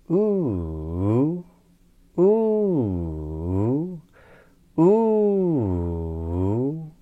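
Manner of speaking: "ooh" swooping high, down, then up; circumflex glide. Shown as a demonstration. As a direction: glide an ooh through rise, fall, rise. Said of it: "this circumflection sounds a bit like this".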